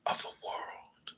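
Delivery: hushed whispered ending. Acted. Declaiming a dark creed, fading to a whisper. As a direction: breathe it out, no voice.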